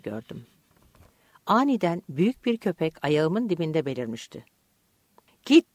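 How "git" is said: sharp, high narrated shout; short, raised-voice order; shouted command. A narrator's reading. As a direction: shout the dog away. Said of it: "diye bağırdı Sokrates".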